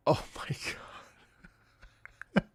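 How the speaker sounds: voiceless, scandalised gasp of words; shocked whisper. Retold or imitated. Streamer re-enacting shock at mother-in-law's translation, whispered. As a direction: whisper it as if stunned, acting it out.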